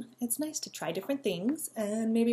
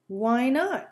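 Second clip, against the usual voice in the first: cry out; 4 semitones higher, 7 dB louder than usual. Acted. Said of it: "cried Squirrel".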